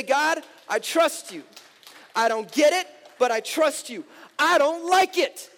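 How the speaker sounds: loud, high, impassioned sermon delivery; full-force preaching. Acted. Pastor urging the church to trust God, full-force preaching. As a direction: hammer each declaration out to the congregation.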